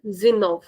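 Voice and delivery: zenove with no de; casual clipped form; dropped de. Shown as a demonstration. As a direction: clip dezenove to zenove. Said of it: "will not say dezenove".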